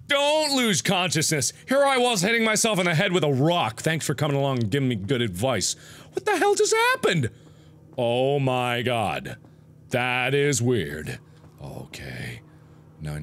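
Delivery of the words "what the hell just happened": very high, squeaky disbelief; shocked disbelief. Real, not acted. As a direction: squeal the question in bewildered shock. Bewildered by sudden game event.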